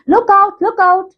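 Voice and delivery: high-pitched mock warning call; imitated shouting. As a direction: imitate a shout by lifting the pitch, not the volume. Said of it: "he was shouting in a very loud voice".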